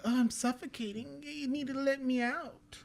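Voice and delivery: put-on high, soft, pleading little child voice; a trapped child begging to be let out; child's voice. Trapped child in the suitcase begs to be let out, high and soft. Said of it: "a child's voice is coming from".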